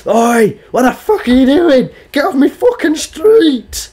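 high, slow mock yell at a driver; mock angry granny. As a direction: ham up a furious old woman chasing you off her street. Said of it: "She would never run up to your car and".

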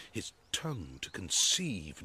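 normal talking voice

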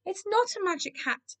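small, high, soft voice; sad admission. A narrator's reading. Keith owning up to the cats, sad. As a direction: confess it meekly. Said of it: "he admitted sadly".

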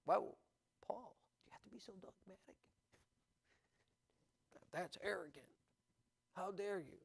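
whispered, quick, pearl-clutching complaint; mock objector whisper. Preacher voicing a critic scolding Paul, mock whisper.

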